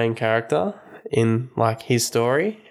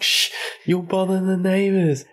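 hushing dad; 7 semitones higher than usual. Retold or imitated. Father hushing son.

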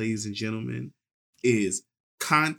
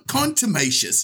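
higher-pitched attempt at an English accent; mock English accent; 6 semitones higher than usual. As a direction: try the word in a put-on English accent. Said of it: "Use your English accent".

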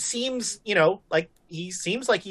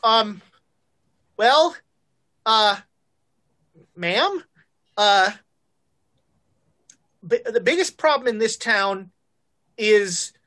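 put-on halting, higher, flustered young deputy; nervous; 3 semitones higher than usual. Nervous deputy answers with two bosses watching, flustered. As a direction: stall with ums, hunting for a safe answer. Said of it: "he is super nervous right now".

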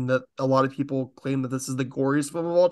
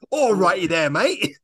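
louder, higher put-on Aussie-style accent; hammed-up accent greeting, pitch rising on mate; 4 semitones higher, 5 dB louder than usual. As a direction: perform the accent on request, cheerfully. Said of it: "I want you to do that accent again".

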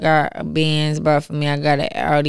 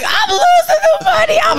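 half-laughing mock sob, pitched way up; mock crying wail. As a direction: wail it like a kid losing it all at the slots. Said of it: "and I'm crying because".

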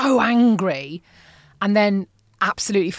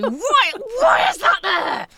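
mock furious shout; 13 semitones higher, slower than usual. Venting at family over pantry mayonnaise, furious shout. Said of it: "absolute incandescent rage".

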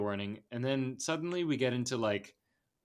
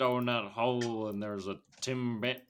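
bad Southern accent; 2 semitones lower than usual. Shown as a demonstration. Podcaster doing a detective bit about donuts, robotic fake Southern accent. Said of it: "this is the worst accent".

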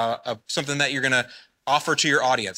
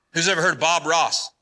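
usual speaking voice

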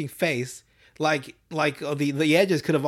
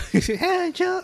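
high, badly faked old-man voice; thin attempt at a cartoon creep, laughing; Herbert the Pervert. Friend requested a cartoon impression, faltering high voice. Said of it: "I can't do his voice either".

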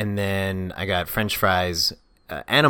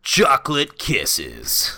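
slow silly voice; 4 semitones higher, much slower than usual. Pretend. Slow silly not-recommend item.

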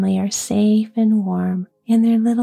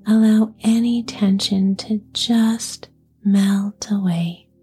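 slow, whispered relaxation guidance; guided meditation. Acted. Leads a relaxation exercise.

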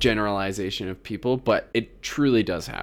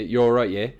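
louder British catchphrase; British accent; 2 semitones higher, 5 dB louder than usual. Shown as a demonstration. Showing off the accent for the impressed co-host, British accent. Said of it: "What, what did it say?".

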